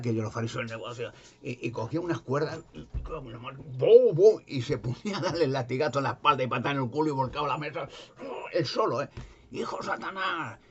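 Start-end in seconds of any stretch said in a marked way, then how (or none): 3.6-4.4 loud high "bum, bum" whip cracks; whip crack noise. Retold or imitated. Jesus lashing the merchants, loud whip sounds. 9.5-10.6 angry rebuke. Retold or imitated. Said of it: "decía".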